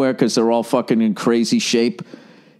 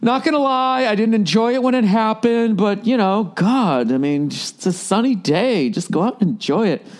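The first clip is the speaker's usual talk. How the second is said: higher, breezy mellow voice; forgiving brain; 9 semitones higher than usual. Pretend. The forgiving brain shrugging it off, in a higher breezy voice. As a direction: let it all go, easy and carefree. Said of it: "Your brain's all forgiving".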